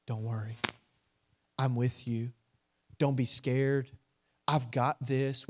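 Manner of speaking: soft, low, unhurried comfort; a still small voice, low and soothing; gentle reassurance. Acted. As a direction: reassure them quietly, with room between phrases. Inner voice of God reassuring.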